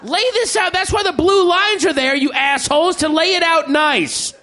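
high-pitched exasperated boss tirade; angry editor rant. Acted. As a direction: chew out the staff like a furious editor. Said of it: "He's like".

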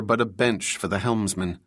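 ordinary delivery

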